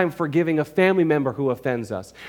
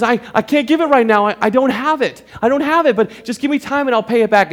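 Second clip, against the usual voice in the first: pleading friend; 7 semitones higher, 4 dB louder than usual. Acted. Friend begs for time.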